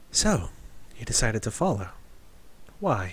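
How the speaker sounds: a hint of a soft-spoken elvish lady; understated elvish feminine voice, softly questioning; elvish woman. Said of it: "feminine voice with a, a little elvish".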